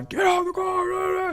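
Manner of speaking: high, slow mock cop shouting an order; hammed-up high yell of a cop. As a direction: bark the command like a panicked cop. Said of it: "They get out of the car".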